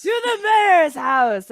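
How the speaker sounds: high, loud, stretched-out rallying cry; rallying shout. Pretend. Game master echoing the players' cry, rallying shout. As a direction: draw it out as a rousing call.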